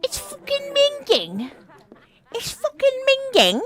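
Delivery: very high, loud silly put-on voice; dumbass voice. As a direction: squawk it like a dim lad. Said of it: "having too much fun with that dumbass voice".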